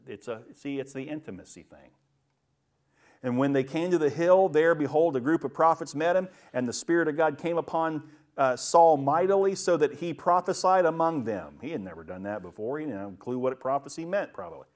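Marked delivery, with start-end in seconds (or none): 3.2-11.6 loud, emphatic Bible reading; scripture reading. A narrator's reading. Preacher reading Saul's story to the congregation, loud and emphatic. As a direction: proclaim the verse at a raised volume.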